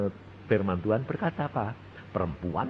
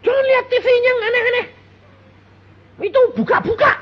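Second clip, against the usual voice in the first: much higher, louder, fast fussing mother; nagging mother; 9 dB louder, much faster than usual. Retold or imitated. Preacher imitating mothers warning their kids, high and fussy. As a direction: squeal the warning like a fretful mum. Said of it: "Sebab ibu-ibu kerkali gini".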